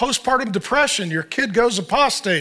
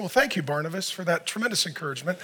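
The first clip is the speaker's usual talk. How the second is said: softer, dry mock gratitude; retelling his sarcastic reply to the pastor, softer and dry; sarcastic thanks; 5 semitones lower, 9 dB quieter, faster than usual. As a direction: thank him while meaning the opposite.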